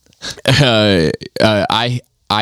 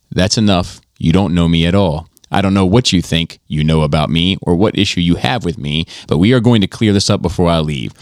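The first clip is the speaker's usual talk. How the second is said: ordinary talk, nothing marked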